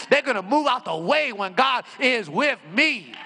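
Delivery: loud, high preaching climax; loud exultant shouting preacher; full-force preaching. Acted. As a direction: preach it to the rafters.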